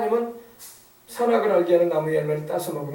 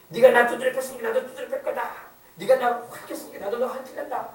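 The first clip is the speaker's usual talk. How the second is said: acted tit-for-tat anger, pitched higher; mock vengeful threat; 4 semitones higher than usual. Beaten spouse threatens to hit back, mock-angry. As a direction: play a beaten spouse vowing payback.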